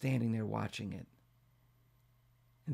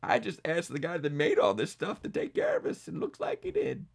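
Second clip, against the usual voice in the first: higher, amused and humble; chuckling old bear; 11 semitones higher, 5 dB louder than usual. A narrator's reading. An old bear tells the animals he only asked God, amused. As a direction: laugh it off modestly. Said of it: "Bartholomew laughed".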